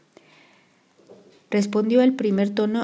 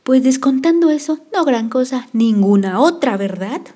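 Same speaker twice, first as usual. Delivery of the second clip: loud, much higher shout into the camera; 5 semitones higher, 8 dB louder than usual. A narrator's reading. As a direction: yell it right into his face. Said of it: "gritó".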